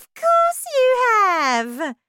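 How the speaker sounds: very high, loud scornful retort; scornful wife. Acted. Wife insisting to her husband, scornful. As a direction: snap it back. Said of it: "his wife said".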